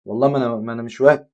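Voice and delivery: usual speaking voice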